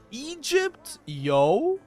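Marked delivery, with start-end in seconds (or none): none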